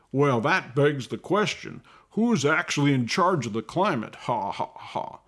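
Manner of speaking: mocking congressman voice; put-on voice ending in a hollow "ha, ha, ha"; Rick Allen. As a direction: parody a smug politician, with a fake laugh. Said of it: "you see Congressman Rick Allen".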